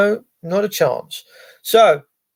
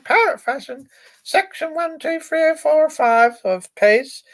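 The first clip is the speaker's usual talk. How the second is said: parrot-fashion recitation; 10 semitones higher than usual. Retold or imitated. Mocking rote recitation by police.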